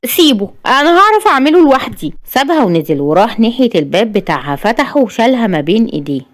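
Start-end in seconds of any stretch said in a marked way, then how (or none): 0.1-2.1 high-pitched irritated rebuff; tense, raised-pitch insistence; sharply. A narrator's reading. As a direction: push the help away sharply. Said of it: "عشان تقول بحدة".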